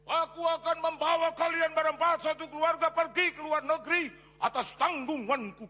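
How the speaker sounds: loud proclaiming prophecy; loud prophetic declaration. Retold or imitated. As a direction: announce it like a prophecy. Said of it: "perkataan yang keluar bilang begini".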